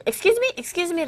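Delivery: high-pitched, rising irritated query; mock an impatient demand to say it over; annoyed. Native making a foreigner repeat it, annoyed. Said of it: "짜증나게 좀 다시 얘기하라는 식으로".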